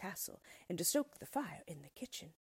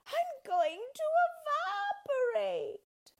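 very high, loud panicked cry; panicking witch; 7 dB louder than usual. Acted. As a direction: panic as she melts. Witch melting.

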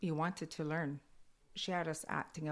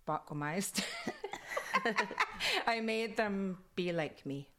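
ordinary delivery

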